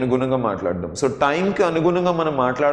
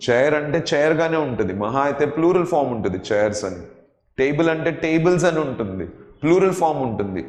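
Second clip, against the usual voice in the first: ordinary talk, nothing marked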